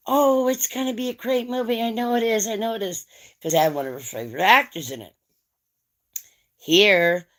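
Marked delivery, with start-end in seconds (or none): 0.1-3.1 high, insistent gushing; imitated eager friend. Friend recommending a movie at her house, eagerly imitated.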